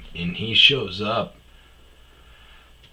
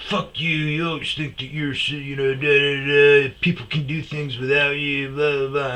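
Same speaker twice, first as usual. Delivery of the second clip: higher-pitched, mildly indignant imitation; mock angry drunk friend; 5 semitones higher than usual. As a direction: babble a drunk buddy's petty protest. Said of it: "You're getting angry for no reason".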